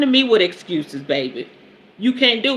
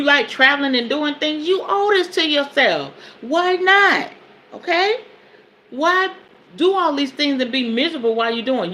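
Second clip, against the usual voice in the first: higher, urging the viewers to change; fired-up preaching; 5 semitones higher than usual. Real, not acted. Host urging her viewers to fix their lives, fired-up preaching. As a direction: push it like a sermon, pitch up.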